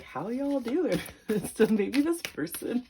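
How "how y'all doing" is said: put-on mild Southern accent, higher and a bit slow; Southern twang. Reader imitating a Southern crush, light accent. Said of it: "Somebody has a Southern twang".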